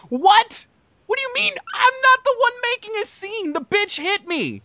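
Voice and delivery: very high, incredulous outburst; squeaky-high retold indignation; indignant protest. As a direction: re-enact an outraged argument with a friend. Acting out quarrel with his host.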